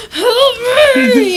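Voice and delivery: loud high laughing wail; mock cry for help. Friend voicing paralysed husband, hammed-up laughing wail. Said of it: "I'm like".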